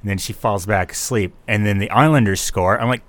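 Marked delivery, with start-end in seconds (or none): none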